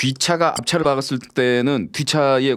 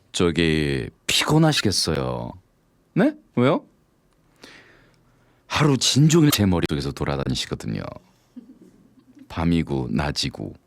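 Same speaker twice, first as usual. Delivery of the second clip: cheesy pickup line; 8 semitones lower than usual. Acted. Man delivering a greasy pickup line to a woman.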